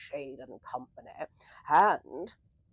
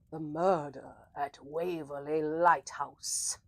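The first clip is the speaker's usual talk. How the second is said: story narration intro; 5 semitones lower than usual. Acted. Announces the story title.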